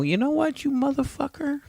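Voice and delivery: high mock voice at the bird; squeaky indignant insult; cursing the escaped bird in a high mock voice. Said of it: "And I go".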